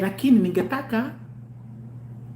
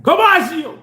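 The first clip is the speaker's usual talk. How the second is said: higher, louder exclamation; high shout; 9 semitones higher, 6 dB louder, slower than usual. Real, not acted. Shouting to the listeners in triumph. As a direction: call it out loud.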